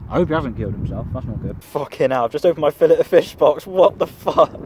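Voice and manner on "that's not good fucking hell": ordinary talk, nothing marked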